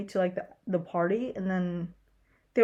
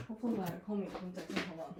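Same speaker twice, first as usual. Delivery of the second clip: usual speaking voice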